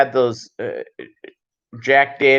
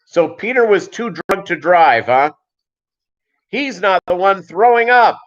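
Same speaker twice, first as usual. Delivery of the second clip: wife's scolding, raised in pitch; imitated angry yelling; 7 semitones higher, 4 dB louder than usual. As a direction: scold like a fed-up wife, high and emphatic. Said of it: "as the wife is yelling at him".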